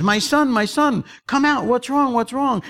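high-pitched urgent coaxing; worried master calling, voice lifted high; concerned master. Acted. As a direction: call out to coax a frightened servant. Master coaxing frightened servant out.

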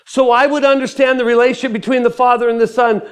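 high, loud objection to the Lord; earnest protest to God. Acted. Answers back to God in dialogue.

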